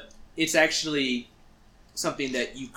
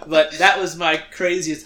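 laughing wrap-up; 4 semitones higher, 10 dB louder than usual. Real, not acted. Laughing after story punchline.

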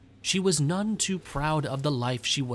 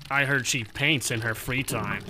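lightly voiced lower gossip, confiding; second gossiping coworker; 4 semitones lower than usual. Another coworker whispering rumours about Gibby, lightly voiced. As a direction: pass on the rumour like a bored coworker. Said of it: "they wasted time by gossiping about her".

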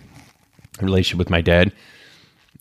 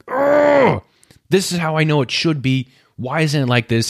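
frustrated anger; 7 semitones higher than usual. Retold or imitated. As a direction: vent the frustration that things won't go your way. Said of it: "how I felt with the anger".

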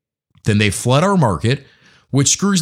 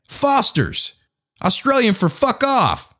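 mock beer ad; 12 semitones higher, slower than usual. Parody of a Foster's beer ad.

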